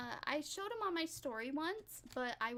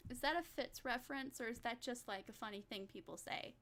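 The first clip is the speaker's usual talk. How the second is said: ordinary delivery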